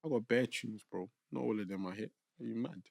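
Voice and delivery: ordinary talk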